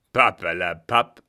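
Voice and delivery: loud scoffing brush-off; dismissive. Acted. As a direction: wave her off with scorn. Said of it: "antwortete er achselzuckend".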